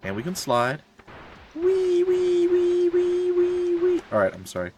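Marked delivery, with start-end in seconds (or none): 1.5-4.0 high, playful wee sounds; gleeful squeal. Acted. Player sliding in game, high gleeful squeals. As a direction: squeal like a kid on a slide.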